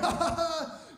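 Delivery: high, put-on mocking laugh; imitated guffaw at a victim; acted jeering laugh; mock crew laughter. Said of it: "They all start laughing at me".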